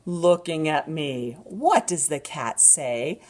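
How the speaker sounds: slow, animated picture-book reading; drawn-out storytime voice with gasps; reading to a toddler. Acted.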